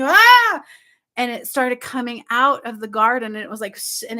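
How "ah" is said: high, loud yelp of fright; frightened scream. Retold or imitated. Woman backing away from the snake, frightened scream. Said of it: "I became scared, and I started backing up".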